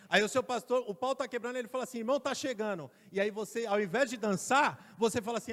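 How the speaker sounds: loud, high preaching voice; fiery preaching. Acted. Preacher exhorting the congregation, loud and high. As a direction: preach it out to the church.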